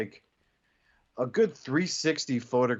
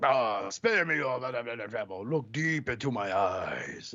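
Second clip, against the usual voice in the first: hypnotic villain; slower than usual. Retold or imitated. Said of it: "what was it in Headmaster's like".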